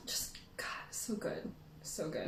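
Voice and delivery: soft breathy whisper of delight; awed whisper. Real, not acted. Whispering about the oyster omelet she still craves. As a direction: savor it under your breath.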